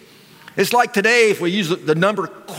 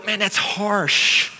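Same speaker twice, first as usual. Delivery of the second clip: dismayed reaction; much slower than usual. Retold or imitated. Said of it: "you go".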